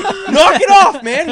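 loud, high, drawn-out scolding; exasperated yell. Retold or imitated. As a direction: yell at a snorer to quit. Retold quarrel with snoring dad.

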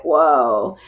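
low, long-held awed whoa; stunned reaction. Retold or imitated. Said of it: "felt like he punched me between the eyes".

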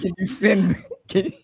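trying to read through laughter; laughing. Real, not acted. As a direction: quote broken up by laughter. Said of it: "let me get this out without laughing".

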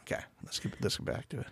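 breathy hush to get back on track; quick unvoiced murmur; whispered aside. Real, not acted. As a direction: whisper it to yourself. Stepping back after laughing.

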